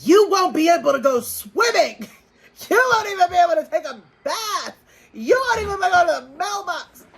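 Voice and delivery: high, fast mocking impression; hammed-up high voice of the angry girlfriend; mocking her outburst. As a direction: pitch way up to parody her. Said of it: "I did laugh when she was like".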